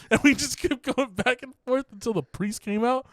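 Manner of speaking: ordinary delivery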